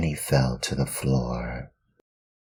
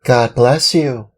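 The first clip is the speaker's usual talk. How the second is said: put-on higher, louder puppet boy voice; a polite little wooden boy; Pinocchio; 10 semitones higher, 7 dB louder than usual.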